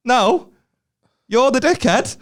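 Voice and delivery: high-pitched, indignant put-down; mock crowd retort. Crowd turns on the heckler, mock indignant. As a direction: throw it back as an exasperated audience.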